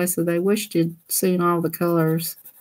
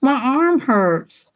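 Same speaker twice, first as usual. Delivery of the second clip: whiny sister; 6 semitones higher, much slower than usual. Retold or imitated. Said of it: "She says".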